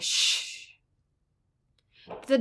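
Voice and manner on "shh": long, soft hissed shh; shushing door sound. Shown as a demonstration. Writing teacher voicing the door opening in a book, hissed sound effect. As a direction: imitate a door with a drawn-out shh. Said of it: "The door opened".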